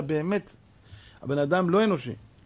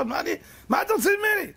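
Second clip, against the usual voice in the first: exasperated shout; 14 semitones higher, faster than usual. Retold or imitated. Noah fed up with the animals' demands, shouting high. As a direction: throw up your hands and shout it.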